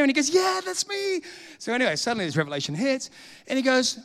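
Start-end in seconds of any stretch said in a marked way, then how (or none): 0.3-1.2 high, delighted-sounding cry; excited confirmation. Retold or imitated. Old acquaintance finally remembered by the preacher, excited. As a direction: imitate a man thrilled to be remembered. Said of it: "he's so excited".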